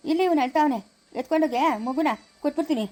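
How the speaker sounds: ordinary delivery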